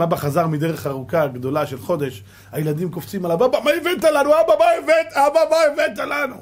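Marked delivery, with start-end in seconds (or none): 3.4-6.4 put-on much higher, louder eager kids' cries; excited children. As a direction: beg father for presents like little kids. Children jump on returning father. (ילדים מתלהבים דורשים מאבא מתנה)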